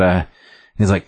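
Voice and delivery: ordinary talk, nothing marked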